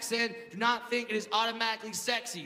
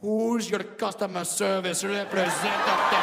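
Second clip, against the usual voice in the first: hammed-up Indian-accented dirty talk; mock-sexy Indian accent. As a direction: croon it suggestively in an Indian accent. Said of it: "whisper something soft and sexy".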